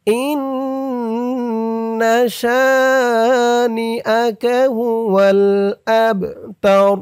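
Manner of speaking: slow melodic tajweed chant; Quran recitation. Shown as a demonstration. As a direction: draw out every vowel in recitation. Recites Quran verse slowly.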